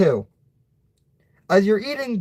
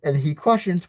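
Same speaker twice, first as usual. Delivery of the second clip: usual speaking voice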